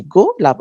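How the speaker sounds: গোলাপ parted as "go, lap"; split into syllables. Shown as a demonstration. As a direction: break গোলাপ after its first syllable. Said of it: "দুই ভাগে ভাঙতে পারছি".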